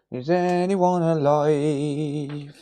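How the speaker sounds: slow, eerie, drawn-out haunting call; spooky ghost voice. Pretend. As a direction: wail it like a ghost in an empty house. Spooky repeat of empty-stream call.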